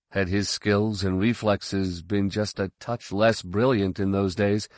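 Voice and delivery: ordinary delivery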